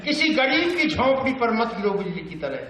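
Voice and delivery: high, urgent verse recital; Bhavani Prasad Mishra. Acted. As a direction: exclaim the command, then declaim high. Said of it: "बातचीत की शैली".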